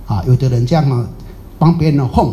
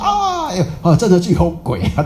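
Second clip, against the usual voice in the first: mock high-pitched cry of shock; startled fright; 5 semitones higher than usual. Sceptic tricked by a ghost, startled cry. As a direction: act out a sudden scare.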